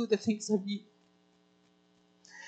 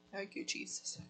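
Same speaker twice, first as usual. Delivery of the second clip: whispered prayer; 15 dB quieter than usual. Real, not acted. Prayer after emotional sermon point.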